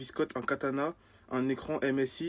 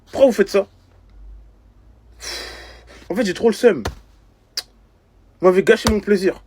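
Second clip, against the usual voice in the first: high, very loud indignant shouting; outraged ranting; 6 semitones higher, 13 dB louder than usual. Acted. Victim raging at those who signed for him, outraged. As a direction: yell your complaint, aggrieved and dramatic. Outraged rant about fake petition signature.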